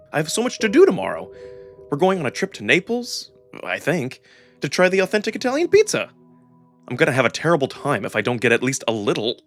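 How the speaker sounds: normal talking voice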